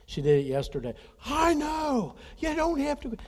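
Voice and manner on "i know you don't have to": high-pitched, loud mock yell of a teen girl; daughter screaming back. Imitating his daughter yelling back at her dad during the race. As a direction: squeal it back like an annoyed daughter mid-race. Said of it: "she will scream back at me".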